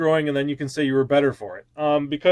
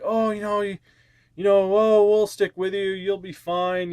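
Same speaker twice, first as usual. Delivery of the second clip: higher, sing-song fake comfort; mock sugary sympathy; 6 semitones higher than usual. Fans in the feed comforting a creator, mock sugary sympathy. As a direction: coo it like a fawning fan. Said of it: "they're like".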